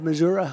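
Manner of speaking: "Missouri" said as Mizzou-ruh; "Missouri" with the local -uh ending; local pronunciation of Missouri. Real, not acted. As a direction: finish "Missouri" with an uh instead of ee. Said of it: "I say it that way".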